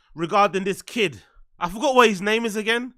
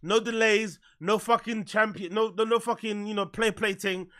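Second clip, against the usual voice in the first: ordinary talk, nothing marked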